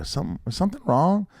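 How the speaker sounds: high, tentative rising question; puzzled concern. Retold or imitated. Actor to producer over repeated retakes, puzzled and uneasy.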